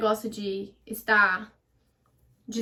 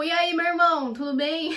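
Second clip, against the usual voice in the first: higher, louder forced-chummy greeting; overdone casual; 5 semitones higher, 4 dB louder than usual. Acted. Trying to stay casual with the guys, overdone chummy. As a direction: greet like a buddy, trying too hard. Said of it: "tentando ficar casual".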